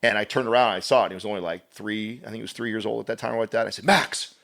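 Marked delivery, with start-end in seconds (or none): 3.8-4.3 sudden name shouted high and loud; sharp yell. Retold or imitated. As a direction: yell at the kid to stop him touching the outlet. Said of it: "he never heard that tone from his father".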